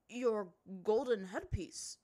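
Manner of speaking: slow, deliberate chief; a ruler explaining with weight; stern chief. A narrator's reading.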